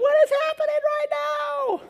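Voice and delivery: high, loud shriek of panic; panicked scream. Retold or imitated. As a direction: shriek it in terror. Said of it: "everyone would be like".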